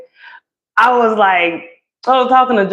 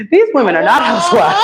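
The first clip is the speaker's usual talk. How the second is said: laughing; 12 semitones higher, faster than usual. Retold or imitated. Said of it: "I was like".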